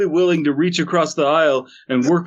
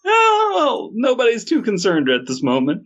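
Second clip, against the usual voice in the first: mock cracking voice; 11 semitones higher than usual.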